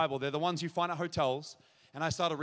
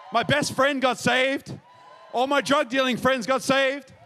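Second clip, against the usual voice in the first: loud, higher-pitched testimony, ringing out; impassioned preaching; 5 semitones higher, 6 dB louder than usual. Real, not acted. Preacher tells the crowd who got saved, impassioned preaching. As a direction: preach it with mounting excitement.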